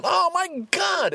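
drawn-out, very high oh my God; slow exasperated wail; exasperated groan. Real, not acted. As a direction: despair at the stupid iPad. Exasperated at password loop.

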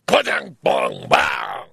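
clumsy vocal imitation of a bumper crash; bad crash sound effect. Driver imitating his bumper hitting the car ahead, hammy crash noises. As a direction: mouth the crash noises, amateurishly. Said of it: "I'm not good at sound effects".

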